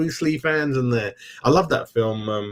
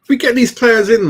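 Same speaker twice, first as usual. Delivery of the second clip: excited burst; 11 semitones higher, 7 dB louder than usual. Real, not acted. Excited about transfers.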